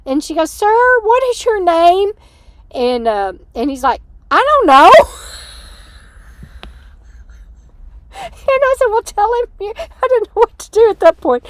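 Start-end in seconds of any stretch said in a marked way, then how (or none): 0.5-2.1 high, loud mock hail; imitated calling out. Sister calling out to a stranger. 4.3-4.9 high mock yell; imitated loud retort. Man's blunt answer, imitated. 4.9-11.5 high, loud words through laughter; real laughing. Real, not acted. Laughing while telling the story.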